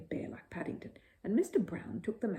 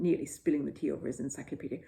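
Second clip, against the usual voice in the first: usual speaking voice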